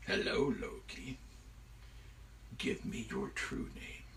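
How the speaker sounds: hushed, soft demand; quiet menacing giant. Acted. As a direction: loom over the captive without raising your voice.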